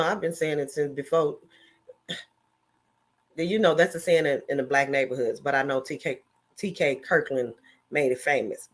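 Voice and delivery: ordinary talk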